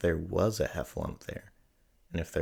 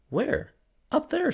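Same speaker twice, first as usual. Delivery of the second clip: high, anxious voice; frightened squeak; 4 dB louder, slower than usual. A narrator's reading. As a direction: point and squeak it out nervously. Piglet frightened, points.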